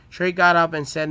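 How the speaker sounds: plain reading voice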